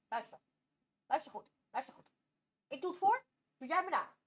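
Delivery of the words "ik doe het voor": usual speaking voice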